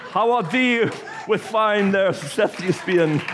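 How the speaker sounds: high, laughing hammed-up old English greeting; mock old English.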